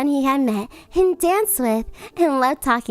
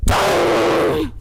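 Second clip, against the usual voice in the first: booming chime; clock chime; 3 semitones higher, 3 dB louder than usual. Acted. The clock chiming for midnight, booming. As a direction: ring it out like a big clock.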